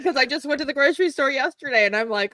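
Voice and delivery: quick, high-pitched talk through laughter; real laughing. Real, not acted. Explaining why the grocery talk is funny, laughing. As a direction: rush the explanation out while laughing. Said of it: "I'm laughing 'cause".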